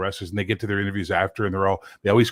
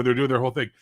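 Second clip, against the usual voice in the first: ordinary talk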